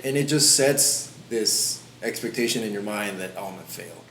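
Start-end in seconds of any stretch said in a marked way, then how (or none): 3.4-4.0 defeated mutter. Retold or imitated. Voicing defeatist inner thought.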